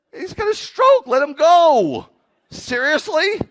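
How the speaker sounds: high, loud comic outburst; exasperated shout. Retold or imitated. Owner protests treating the old cat, loudly exasperated. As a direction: holler it in disbelief, stretching the words.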